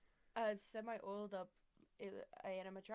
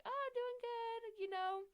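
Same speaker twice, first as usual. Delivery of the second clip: very high and slow; high squeaky reply; 14 semitones higher, 5 dB louder, slower than usual. Retold or imitated. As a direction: squeak it out, drawn out.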